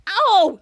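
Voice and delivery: high, loud yelp; retold ow; mock yelp of pain. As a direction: cry out as your wrist is grabbed. Charlotte grabbed by wrist.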